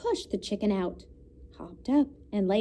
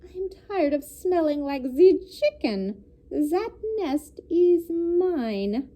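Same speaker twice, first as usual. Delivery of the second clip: higher, slow put-on duck voice; huffy duck; 6 semitones higher, 3 dB louder, slower than usual. A narrator's reading. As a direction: claim it like a fed-up duck. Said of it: "cried the duck".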